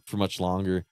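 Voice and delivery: normal talking voice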